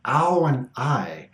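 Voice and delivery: "ow" and "I" sounded out slowly; vowel sounds demo. Shown as a demonstration. Teacher sounds out the two vowels "ow" and "I" for learners. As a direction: draw out the sounds "ow" and "I" as examples. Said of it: "a couple of vowel sounds".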